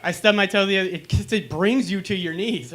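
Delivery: ordinary delivery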